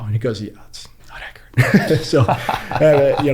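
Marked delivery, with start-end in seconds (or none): 0.4-1.4 fast hushed whisper, no voice; mock whisper. Retelling a man's quiet correction, whispered. 1.6-3.3 laughing. Real, not acted. Laughing at being corrected.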